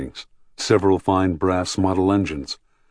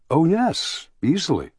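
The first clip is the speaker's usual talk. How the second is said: put-on much higher, lilting reply to a child; easy agreement; 10 semitones higher than usual. A narrator's reading. Visitor answering a small child's question, high easy agreement. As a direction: agree readily, pitched well up.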